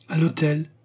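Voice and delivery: appel plus aigu lancé au cocher; crié au cocher. A narrator's reading. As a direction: lancer l'ordre au cocher, plus haut. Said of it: "cria Turquoise au cocher". (Turquoise crie au cocher d'aller à l'hôtel, voix haute)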